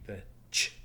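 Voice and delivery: "ch" spat out with no voice; a voiceless breathy "ch"; unvoiced ch sound. Shown as a demonstration. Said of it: "ch sound at the end".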